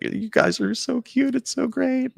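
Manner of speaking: high, loud gushing husband voice, a bit slow; mock-gushing husband cooing over the couple; proud husband gushing. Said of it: "like, all proud of us".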